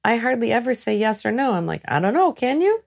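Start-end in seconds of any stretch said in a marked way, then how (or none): 1.9-2.8 high, teasing counter-question with a lifted end; playful challenge. Acted. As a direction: toss the question back, pitched up high. Acts out her usual questioning reply.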